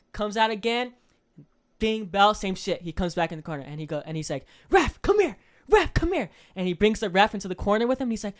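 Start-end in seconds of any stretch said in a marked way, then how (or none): 4.7-6.3 high, loud call to the ref. Retold or imitated. Coach calling the referee into the corner, loud. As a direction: summon the ref sharply.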